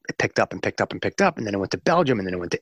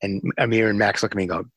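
normal talking voice

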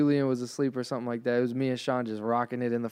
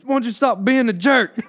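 higher, louder mock dad scolding; dad mode; 10 semitones higher, 8 dB louder than usual. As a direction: imitate a stern dad telling off. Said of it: "Dad mode".

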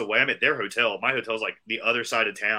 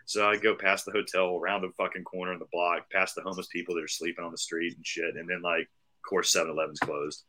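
ordinary talk, nothing marked

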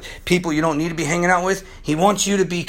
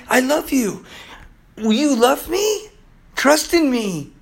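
higher, tender plea, slowing on "Will you love me"; as Jesus, ask gently; voicing Jesus; 8 semitones higher, slower than usual. Preacher voicing Jesus to the listener, high tender plea. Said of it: "He's saying".

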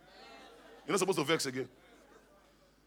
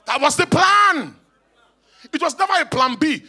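loud, emphatic preacher, pitched up; full-force preaching; 7 semitones higher, 9 dB louder than usual. Real, not acted. Preacher telling church redemption was never plan B, full-force preaching. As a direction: drive each phrase home to the congregation.

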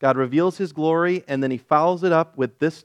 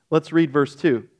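ordinary talk

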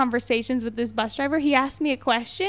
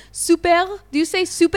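higher-pitched attempt at an Israeli accent; 4 semitones higher, 3 dB louder than usual. Shown as a demonstration. Host asking how co-host says English words, Israeli accent. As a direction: try "super" with an Israeli accent, questioning. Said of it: "I can't even say it in Hebrew".

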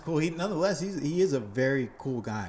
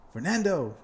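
higher, louder hail across the street; calling out; 7 semitones higher, 6 dB louder than usual. Retold or imitated. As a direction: call him from a distance.

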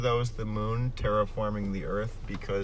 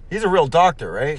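mock suspicious; 6 semitones higher, 9 dB louder than usual. Mock doubting question.

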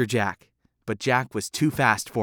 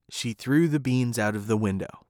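plain reading voice

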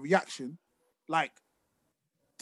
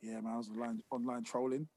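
much softer, lower dad-and-son exchange; a hint of a dad asking about the day; quiet mock dialogue; 6 semitones lower, 12 dB quieter than usual. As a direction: mutter it like a confession. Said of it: "my dad going".

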